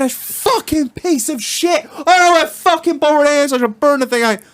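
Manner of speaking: high, squeaky mock rant; mock cussing. Retelling his rant at the dead valve block, a high mock fury. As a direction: squeal it out like a furious cartoon.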